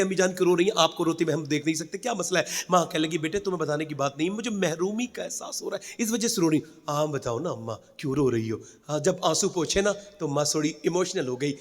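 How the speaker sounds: put-on children gently asking their weeping mother why; tender hushed dialogue. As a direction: hush it down like a tender family moment.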